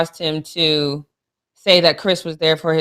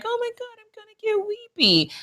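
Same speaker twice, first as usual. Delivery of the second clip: mock weepy; 7 dB quieter than usual. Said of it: "I was like".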